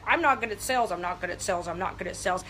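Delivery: low, glum repeated mock self-talk; self-doubting mantra. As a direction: imitate someone talking themselves down. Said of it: "If you're like".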